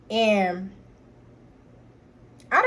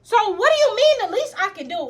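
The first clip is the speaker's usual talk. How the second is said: higher, louder indignant question; outraged; 9 semitones higher, 5 dB louder than usual. Retold or imitated. Sister replaying her outrage at brother, loud. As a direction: demand an answer, incensed.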